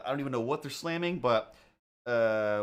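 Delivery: ordinary talk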